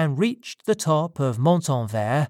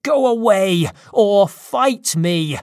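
high, loud furious challenge; raised, defiant yell; angry shout; 5 semitones higher, 6 dB louder, slower than usual. Acted. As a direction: shout him off, stretching the words. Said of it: "I shouted".

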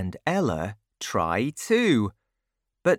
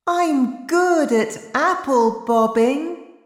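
put-on very high, louder little-kid voice; happy child; 5 dB louder than usual. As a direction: chirp it proudly as a happy small child. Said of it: "says Joe happily".

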